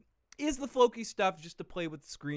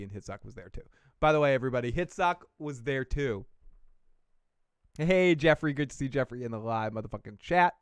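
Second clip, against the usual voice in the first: ordinary talk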